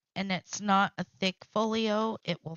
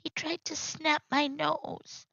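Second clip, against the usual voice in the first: put-on little girl voice; 8 semitones higher than usual. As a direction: speak as a scared small child. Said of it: "She says".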